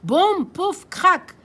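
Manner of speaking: loud punchy sound words; crash effect. Acted. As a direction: bang out the crash. (boum pouf crac lancés fort)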